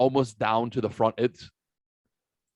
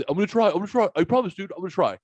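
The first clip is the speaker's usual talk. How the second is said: put-on higher, eager kid voice promising; mock the kid's earnest promise, pitched up; imitating the shy kid; 8 semitones higher, much faster than usual. The shy kid leaving, promising to try, imitated higher. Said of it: "That's his".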